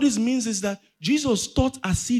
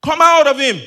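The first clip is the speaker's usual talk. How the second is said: loud, stern command, repeated; Jesus; 4 semitones higher, 9 dB louder than usual. A narrator's reading. Preacher reads Jesus commanding the impure spirit, loud stern order. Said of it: "said Jesus sternly".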